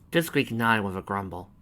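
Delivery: plain reading voice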